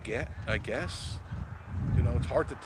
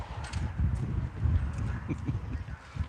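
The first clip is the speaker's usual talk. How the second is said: breathy, mostly voiceless chuckle; real laugh; 5 dB quieter than usual. Real, not acted. Amused by Miss Marie's visit, breathy chuckle. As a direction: chuckle on for a few seconds.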